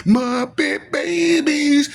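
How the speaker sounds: high, slow drawn-out coo on babies; mock cutesy coo. Teasing the crybabies in a high cutesy coo. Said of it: "I'll be like".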